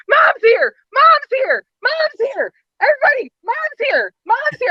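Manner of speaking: very high-pitched mock squeal, repeated like an alarm; imitated excited screaming. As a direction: squeal the news out to everyone. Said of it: "like, screaming in the car".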